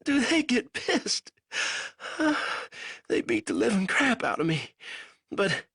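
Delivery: a weak captive fumbling for words; lightly voiced delirious prisoner; feverish prisoner. A narrator's reading. Banks voice, laughing.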